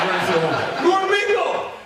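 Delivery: fanboy awe at his heroes' art on the wall; starstruck. Retold or imitated. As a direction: squeal the names like a fan. Starstruck seeing artists' work.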